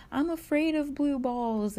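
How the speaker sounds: high childish whine, mocking a kid; whiny kindergartner. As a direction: mimic a spoiled kid refusing. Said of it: "a kindergartner these days would be like".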